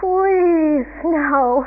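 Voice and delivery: high, loud mock pleading; Calvin. Calvin praying for snow, pleading. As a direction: beg with a drawn-out please. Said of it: "he starts kind of praying".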